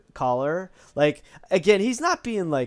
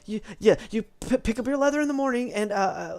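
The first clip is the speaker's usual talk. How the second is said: higher, stammering, flustered apprentice; nervous stammer; 7 semitones higher than usual. Acted. Apprentice boy flustered before the customer, nervous stammer. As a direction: trip over the words, nervous boy. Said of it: "He's, like, nervous".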